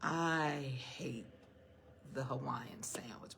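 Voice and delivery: low, whispered, confiding; whispered aside. Real, not acted. Preacher confides to the congregation she hates the sandwich, whispered. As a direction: share it like a secret.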